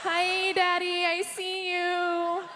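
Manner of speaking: high, slow, breathy-free coo with a giggle; purring at the catcaller in a high slow voice; mock flirty coo. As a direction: draw out a sultry come-on, hammed up.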